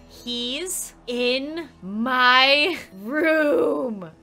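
extremely slow, word by word; stretched, spaced-out horror-story reveal; horrified reveal. Acted. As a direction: drag out each word in dread. Kate texts intruder is inside.

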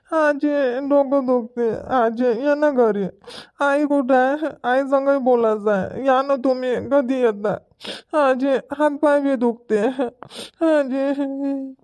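put-on high, crying child's voice; tearful child. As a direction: whimper to grandma, pleading through tears. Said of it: "रडून राहिला काय?". (रडक्या लहान मुलाचा आवाज)

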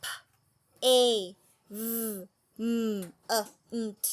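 each letter named separately with a pause between; spelling out letters. Shown as a demonstration. Teacher spelling pavement for Thai students, letter by letter. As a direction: spell the word out letter by letter for the class. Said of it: "ออกเสียงค่ะ".

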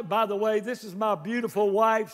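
ordinary talk, nothing marked

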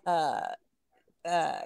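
ordinary delivery

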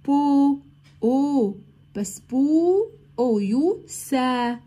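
slow, stretched "بو ... او يو سا" letter by letter; drawn-out spelling drill; sounding out letters. Shown as a demonstration. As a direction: build the word sound by sound for the class. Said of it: "بس بي بي برضو".

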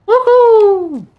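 very high, loud victory cry; excited whoop. Real, not acted. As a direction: shout it out in triumph. Celebrating in the game.

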